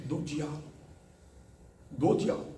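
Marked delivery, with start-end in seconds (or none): 0.1-0.6 soft answer. Shown as a demonstration. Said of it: "Mas através do quê?".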